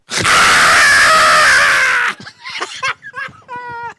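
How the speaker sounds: high, loud helpless laugh; loud laughter. Real, not acted. Laughing hard at his own midget joke, loud and high. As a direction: break into a howl of laughter.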